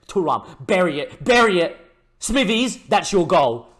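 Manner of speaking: building excited cheer; excited shout. Real, not acted. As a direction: yell at the striker to finish it. Urging striker during counterattack.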